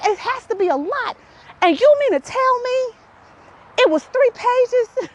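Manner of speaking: very high disbelieving protest; incredulous outrage. Retold or imitated. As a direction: build to an astonished question. Acted disbelief at mom.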